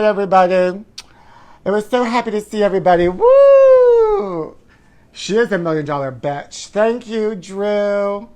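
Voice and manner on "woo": very high, loud party whoop; shrieked pride cheer. Real, not acted. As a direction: cheer it like a parade float. Cheering Happy Pride sign-off.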